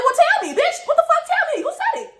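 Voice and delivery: loud, very high-pitched demand. Retold or imitated. Teller demands the friend name who said it, loud and high. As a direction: press urgently, voice shooting up.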